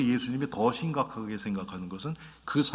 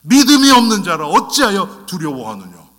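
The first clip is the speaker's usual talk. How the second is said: loud, higher scolding; stern rebuke; 4 semitones higher, 12 dB louder than usual. Retold or imitated. Jesus scolds his disciples for their fear, loud and stern. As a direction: thunder it like a stern master. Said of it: "예수님이 그렇지 않는단 말이에요".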